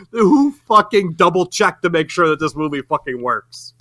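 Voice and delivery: ordinary talk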